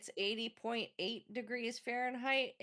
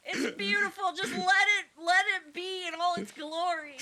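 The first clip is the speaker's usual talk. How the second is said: high mock-weepy plea; hammed-up heartbroken begging; tearful pleading; 9 semitones higher, 8 dB louder than usual. Pleading over giant pumpkin.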